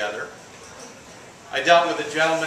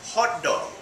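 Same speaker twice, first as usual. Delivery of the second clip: "hot dog" in an Indian accent, higher pitched; 6 semitones higher than usual. Shown as a demonstration. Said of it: "pulls me into my Indian accent".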